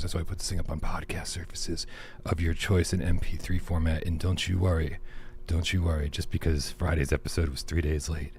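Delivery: low, soft show intro; soothing. Acted. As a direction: lull the listeners, sinking gradually into a whisper. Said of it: "Wow, it's so soothing".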